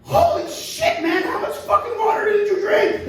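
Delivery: extremely high shocked squeal, laughing; stunned monkey. Acted. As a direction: gasp at the giant gator.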